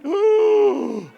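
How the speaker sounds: loud high gasp of alarm; fearful gasp. Pretend. Husband gasps at wife's murder tips, alarmed. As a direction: gasp as if caught off guard.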